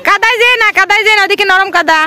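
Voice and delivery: usual speaking voice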